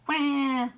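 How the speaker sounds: one high, loud imitated wail; baby seal cry. A narrator's reading. Baby seal following Ebb, high wail. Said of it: "went the baby seal".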